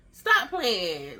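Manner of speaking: loud retold stop playing; mom disbelieving. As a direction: mom calling her bluff. Mom can't believe the news.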